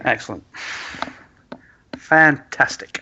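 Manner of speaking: drawn-out, bitterly sarcastic approval; bitter sarcasm. Acted. Client answers the players' deal with bitter sarcasm. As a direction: say it slowly, dripping with sarcasm. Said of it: "she says with bitter sarcasm".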